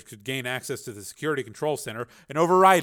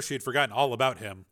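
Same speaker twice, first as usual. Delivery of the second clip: ordinary delivery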